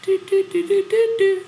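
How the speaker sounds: high sung do do do; high sung jingle. Shown as a demonstration. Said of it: "That's what it does".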